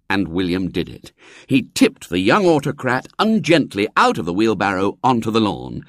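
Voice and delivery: plain reading voice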